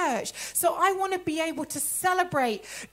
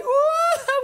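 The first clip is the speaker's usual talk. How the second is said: loud, high-pitched mock reaction; high exaggerated ooh; 11 semitones higher, 7 dB louder than usual. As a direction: squeal an ooh in playful imitation. Acted reaction to friend's news.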